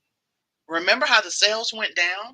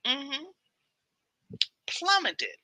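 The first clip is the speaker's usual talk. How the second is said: ordinary talk, nothing marked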